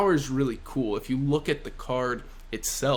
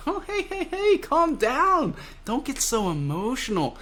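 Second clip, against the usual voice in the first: mock calming; 11 semitones higher than usual. Killer to angry Hawkins, mock calming. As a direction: talk down a hothead in a raised, teasing voice.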